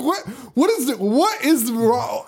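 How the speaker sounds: high-pitched retold disbelief, last words drawn out; baffled. Retelling own baffled reaction to film.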